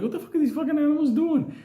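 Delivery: high-pitched retold disbelief; baffled outburst. Comic retelling his own reaction to the animals, baffled.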